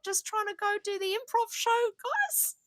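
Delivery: high, lost, polite tourist; British tourist voice. Retold or imitated. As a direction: play a clueless visitor asking directions. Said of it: "gonna be like".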